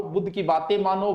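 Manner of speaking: ordinary talk, nothing marked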